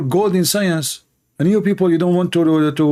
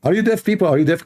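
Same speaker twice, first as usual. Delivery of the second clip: ordinary talk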